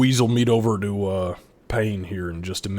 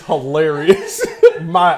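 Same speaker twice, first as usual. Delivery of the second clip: laughing through the words; 5 semitones higher, 9 dB louder than usual. Real, not acted. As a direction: laugh while you say it. Laughing at the joke.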